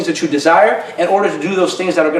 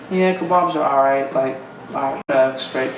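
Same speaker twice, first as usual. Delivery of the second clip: a mumbled, much softer sulky reply; sulky wife; 6 semitones lower, 7 dB quieter than usual. Retold or imitated. Wife sulking to husband about kebabs, muttered grudgingly. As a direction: answer like a wife grudgingly giving in.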